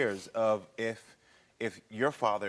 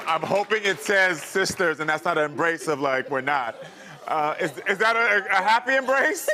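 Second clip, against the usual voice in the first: excited suspense; 6 semitones higher, 7 dB louder than usual. Real, not acted. Host watching reveal anxiously.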